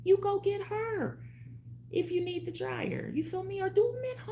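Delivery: high put-on voice. To her viewers, opening in a very high voice. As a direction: pitch it way up and drag it out.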